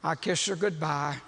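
usual speaking voice

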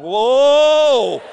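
long, loud, falling whoa; taken aback as the crowd charges; startled whoa. Retold or imitated. Player seeing hundreds of fans charge him, loud startled whoa.

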